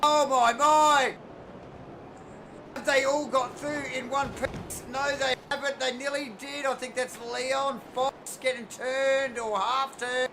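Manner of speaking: loud, high excited race call; play-by-play. Acted. Live play-by-play.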